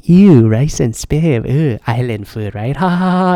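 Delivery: slow, disgusted ew, then a fake laugh; the rude tourist ridiculing rice and Spam, mock disgust; mocking snob. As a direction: sneer at the island food like a snobby tourist. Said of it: "this guy was, like, going".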